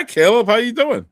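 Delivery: usual speaking voice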